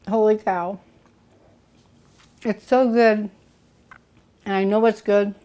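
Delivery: talking through a mouthful of tart; words round a bite of food; mouth full. Real, not acted. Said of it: "talking with my mouth full".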